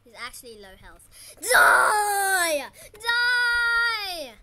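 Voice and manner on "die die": long, very loud yell; battle scream. Real, not acted. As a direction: scream it out to finish off the enemy. Attacking the boss to finish it.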